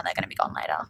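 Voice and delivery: whispered aside. Real, not acted. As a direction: breathe it like a secret. Secret aside about braces.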